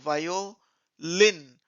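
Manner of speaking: वायोलिन slowly as vayo, then lin; teacher sounding out "वायोलिन"; syllable by syllable. Shown as a demonstration. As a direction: split वायोलिन before a stretched final lin. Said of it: "आयो sound होता है".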